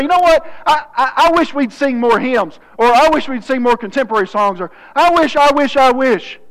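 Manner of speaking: high, loud griping churchgoer; mock complaining. Churchgoer demands favorite songs, mock complaining.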